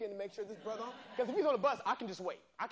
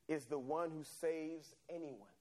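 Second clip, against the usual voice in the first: preaching close; 2 semitones lower than usual. Acted. Preacher sending the congregation out as witnesses. Closing exhortation.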